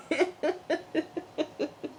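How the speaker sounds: high laugh. Real, not acted. Laughing at herself.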